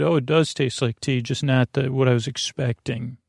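ordinary delivery

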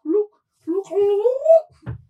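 high, rising crowing cry; clucking hen. Acted. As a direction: crow it out like a hen that just laid. Rooster hatching egg clucks.

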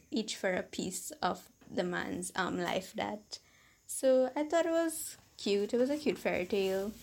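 plain reading voice

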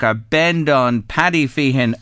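ordinary talk

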